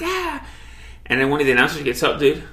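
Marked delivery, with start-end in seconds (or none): 0.0-0.4 very high announcer yelp of excitement; mock commentator squeal; excited yelp. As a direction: let out a high, thrilled squeal.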